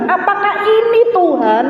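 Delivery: high, imploring question; pleading prayer. Retold or imitated. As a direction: re-enact asking God urgently. Retold questioning prayer to God.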